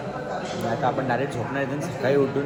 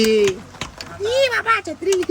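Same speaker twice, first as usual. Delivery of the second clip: much higher, stretched squeal; squeaky mock voice; much slower than usual. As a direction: squeal it in a silly high voice. Playful squeal about the umbrella.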